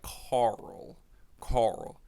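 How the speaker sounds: "Carl" slow, rolling from R into L; R-heavy Carl. Shown as a demonstration. Repeating the right way to say it, "Carl" with a long R. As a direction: stretch the R in "Carl". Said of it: "the right way to say".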